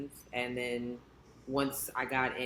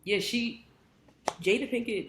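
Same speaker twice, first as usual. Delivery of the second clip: excited gushing; 8 semitones higher, 3 dB louder than usual. Real, not acted. Gushing about actress.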